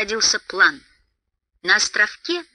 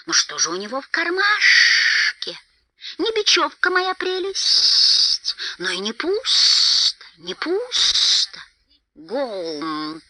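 slow creature voice, drawn-out hissed s; creepy creature musing to itself, slowly; hissing Gollum; much slower than usual. A narrator's reading. Narrator plays Gollum.

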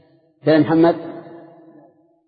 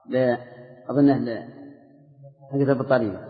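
ordinary delivery

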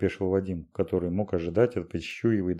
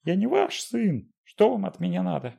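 panicked squeal; 12 semitones higher than usual. A narrator's reading. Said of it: "взвизгнул Вадим".

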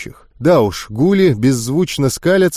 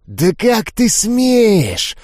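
hissing ghouls; 8 semitones higher, much slower than usual. Acted. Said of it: "зашипели гули".

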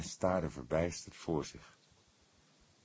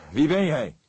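loud, higher, sharp question; sharp demand; 9 semitones higher, 11 dB louder than usual. A narrator's reading. The cook confronts the intruder, loud and sharp. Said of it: "vroeg hij scherp".

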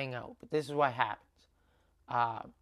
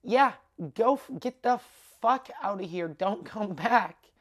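dismissive send-off; 5 semitones higher, 5 dB louder than usual. Shown as a demonstration. Showing what was really meant to Mike, dismissive. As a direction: shoo him away with relish, stretching the end. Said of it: "I was going".

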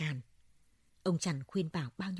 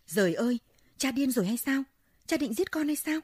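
high, loud cry of terror; panicked shout; 8 semitones higher, 4 dB louder than usual. Acted. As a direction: scream it at your father in panic. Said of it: "còn người con thì kêu toáng lên".

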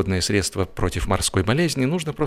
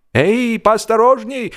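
high, loud call of alarm; urgent cry of caution; shouted warning; 12 semitones higher, 5 dB louder, slower than usual. A narrator's reading. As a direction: call out to stop him falling. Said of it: "сказал я, тряся его за плечо".

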